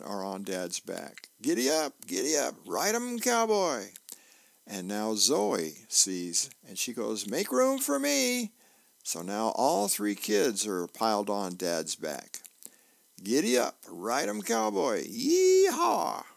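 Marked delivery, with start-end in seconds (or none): 1.4-3.9 put-on high kid voice, rising to a whoop; playful cheering. Two kids riding on Dad's back, cheering. 7.3-8.5 put-on very high, drawn-out kid call; eager child. Zoe sees the piggyback ride, calling eagerly. Zoe wants onto Dad's back. 13.2-16.2 put-on high kid cheers into a loud yee-haw; cowboy whooping. Three kids piled on Dad's back, whooping.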